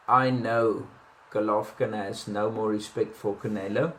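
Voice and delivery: ordinary talk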